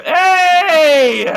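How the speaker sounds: high, loud sudden shout; sharp call out. Real, not acted. Teacher breaks off to call out to a student, a loud high shout. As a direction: snap a student to attention. Calling out a student mid-sentence.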